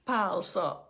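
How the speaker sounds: talking through a big laugh; words bursting with laughter, loud; laughing hard at her younger self. Real, not acted. Laughing at her own story.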